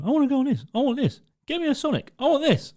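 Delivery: put-on high, nagging kid voice; whiny demanding child. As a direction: beg like a spoiled little boy.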